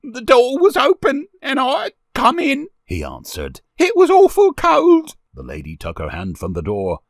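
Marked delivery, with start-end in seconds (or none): 0.0-2.7 put-on very high, loud child voice; small boy defending himself loudly; frightened boy. A narrator's reading. Frightened boy answers the lady. 3.8-5.1 very high, loud wail; frightened boy. A narrator's reading. Frightened boy explains himself.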